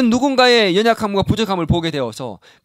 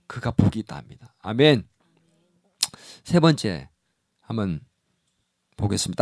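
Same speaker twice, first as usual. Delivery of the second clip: usual speaking voice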